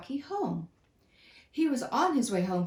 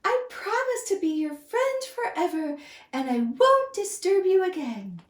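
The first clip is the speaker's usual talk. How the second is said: high, loud squeaky thanks; squealing mouse; 9 semitones higher, 4 dB louder than usual. Acted. Mouse thanks the lion, high and squealing. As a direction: squeal with grateful relief. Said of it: "he squealed".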